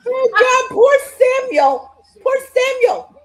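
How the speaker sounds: high, loud groaning sympathy; loud high pity; pained sympathy. Real, not acted. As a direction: wince for poor Samuel. Reacting to wrestling.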